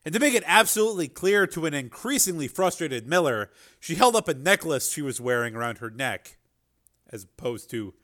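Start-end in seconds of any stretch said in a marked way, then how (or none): none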